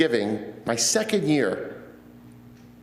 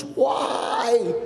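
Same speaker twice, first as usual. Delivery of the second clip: anguished yell; 8 semitones higher than usual. Retold or imitated. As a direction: hurl the question at God. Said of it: "yells at the top of his lungs".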